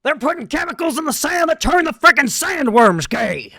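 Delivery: very high, loud, ranting shout in a put-on voice; Alex Jones impression. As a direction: rant like a conspiracy radio host, shouting in a high strained voice. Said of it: "my Alex Jones voice?".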